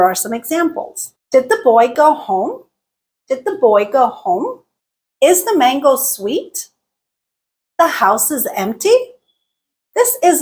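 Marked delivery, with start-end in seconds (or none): 1.3-6.7 yes-no questions climbing on HOME and SWEET; rising intonation. Shown as a demonstration. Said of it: "a rising intonation". 7.8-8.9 statement turned question by a rise on EMPTY; EMPTY goes up as a question; rising intonation. Shown as a demonstration. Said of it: "This type of intonation is used".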